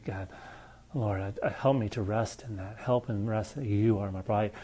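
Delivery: quiet prayer. Shown as a demonstration. Preacher modelling a private prayer, hushed and intimate. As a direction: pray it quietly, like talking alone with God.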